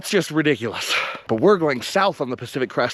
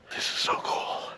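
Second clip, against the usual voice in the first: awed whisper; 10 dB quieter than usual. Real, not acted. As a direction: breathe it out softly. Reaching lookout, awed.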